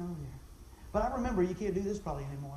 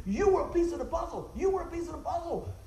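high, loud and emphatic; worked-up retelling, pitched up high; excited declaration; 9 semitones higher, 6 dB louder than usual. Retold or imitated. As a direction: proclaim it with rising fervor. Excited declaration to his old teacher.

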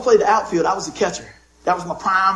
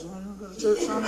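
softer, lips not moving; lipless mumble; 8 dB quieter than usual. Retold or imitated. Churchgoer mocking a timid church invitation, mumbled. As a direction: say it without using your lips. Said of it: "You don't even use your lips".

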